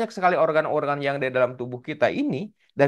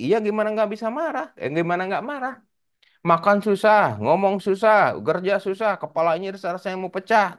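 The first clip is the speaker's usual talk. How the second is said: higher, whiny complaint about the toothache; higher, aggrieved boy listing everything he can't do; aching boy's complaint; 7 semitones higher than usual. Acted.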